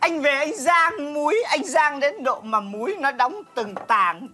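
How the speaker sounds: slow, lingering nostalgic delivery; melodramatic reminiscing. Acted. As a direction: draw out the tender memory with theatrical weight. Theatrical reminiscing to the husband.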